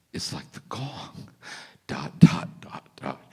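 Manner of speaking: hushed, breathy trailing dots; mock profound whisper. Monk trailing off with mock depth in a whisper. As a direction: whisper the dots like a deep secret.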